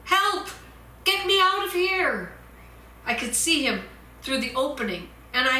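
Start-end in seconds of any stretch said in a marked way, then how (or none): 0.0-2.3 much higher, louder yell; trapped desperate yell; yelling for help. Acted. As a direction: yell through the oven door. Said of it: "I yelled and knocked".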